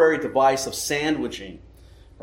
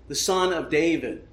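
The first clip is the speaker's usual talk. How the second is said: ordinary delivery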